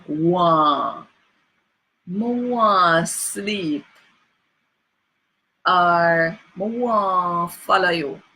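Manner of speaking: "want" said as nasal "wah", slow. Shown as a demonstration. Teacher giving nasal examples with a laugh. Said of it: "that nasal sound is represented".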